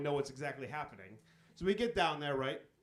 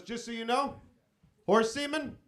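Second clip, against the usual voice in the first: a sly insider tip, pitched up and louder; hushed-up hot tip; 5 semitones higher, 6 dB louder than usual. Retold or imitated. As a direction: sell it like a secret business deal. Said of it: "He goes".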